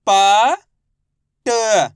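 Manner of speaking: loud प, separate ट, then blended निपट; letter-by-letter reading. Shown as a demonstration. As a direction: sound out निपट with clear pauses. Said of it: "एक अक्षर का उच्चारण करके इनको पढ़ रहा हूँ". (प, ट अलग-अलग ज़ोर से, फिर निपट मिलाकर)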